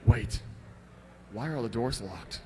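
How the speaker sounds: low, quiet, nervous; hushed and tense. Shown as a demonstration. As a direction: whisper it with dread. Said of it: "You'd be more like that, essentially".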